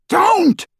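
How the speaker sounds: loud, very high cry of protest; anguished bellow. A narrator's reading. Snape cutting Dumbledore off, anguished bellow. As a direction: bellow it in pain to stop him. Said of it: "bellowed Snape".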